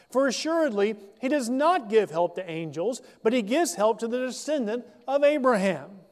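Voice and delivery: formal scripture read. Acted. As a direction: read the verse out to the congregation. Reads scripture passage aloud.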